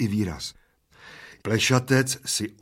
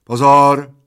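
very loud, raised starter's call; commanding; 4 semitones higher, 14 dB louder than usual. A narrator's reading. Bald man timing his friend, commanding. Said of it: "zavelel plešatec".

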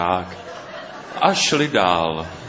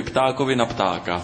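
ordinary delivery, nothing marked

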